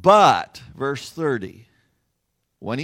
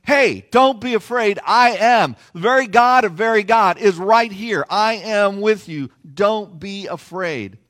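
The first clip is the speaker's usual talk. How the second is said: raised, emphatic reassurance; louder and higher, easing at the end; bold reassurance; 4 semitones higher, 7 dB louder than usual. Acted. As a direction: preach Jesus's words out strongly. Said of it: "He is telling them".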